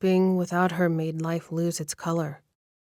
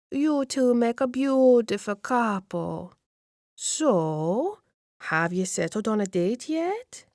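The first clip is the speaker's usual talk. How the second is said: higher, slower fussing grandmother; expectant old lady; 5 semitones higher, slower than usual. Acted. Nona presses the couple about a wedding date, higher cooing old lady. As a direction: coo over the young couple like a matchmaking nonna. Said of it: "Nona smiles at me expectantly".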